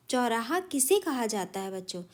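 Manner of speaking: normal talking voice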